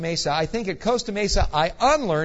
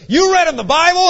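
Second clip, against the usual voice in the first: high, very loud incredulous shout; explosive mock dressing-down; drill sergeant; 12 semitones higher, 13 dB louder than usual. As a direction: bellow the question like a Marine instructor. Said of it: "He is a former Marine drill sergeant".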